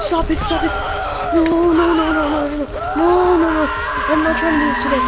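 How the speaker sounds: frantic scared yelling; panicked shouting. Real, not acted. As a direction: scream it in panic. Monster chasing him in game.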